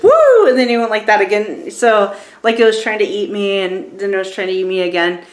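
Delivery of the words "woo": high, loud "woo"; a sudden swooping high whoop; imitated whoop. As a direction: act out the lunge with a whoop. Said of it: "he went like this".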